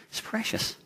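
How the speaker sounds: soft whisper on precious; hushed echo whisper; whispered again. Shown as a demonstration.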